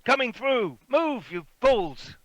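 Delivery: high-pitched, urgent yelling; shouting coach driver. Acted. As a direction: bellow at people blocking your speeding coach. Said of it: "a man on top of it".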